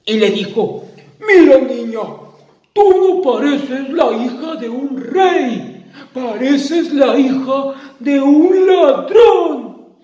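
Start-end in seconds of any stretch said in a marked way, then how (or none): 1.2-10.0 insulto agudo y fuerte, final lento; le escupe el insulto; monstruo enojado. Acted. (monstruo furioso insulta a la niña)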